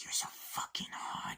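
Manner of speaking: whispered slowly, leering. Retold or imitated. Acting out Dafoe character.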